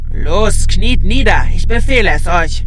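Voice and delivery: loud imperious command; commanding shout. Acted. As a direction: bellow the order to kneel. Mumie befiehlt. (lauter, herrischer Befehl)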